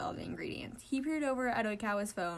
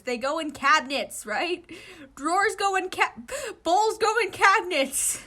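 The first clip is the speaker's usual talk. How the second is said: frustrated; 10 semitones higher, 12 dB louder, slower than usual. Acted. Frustrated searching drawers.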